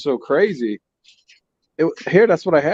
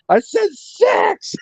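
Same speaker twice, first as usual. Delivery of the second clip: retold scream; much slower than usual. Telling how he got cut off at the window, mock scream. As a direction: replay your scream at a drive-through, held back. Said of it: "so I just screamed in the window".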